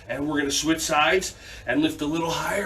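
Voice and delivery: ordinary talk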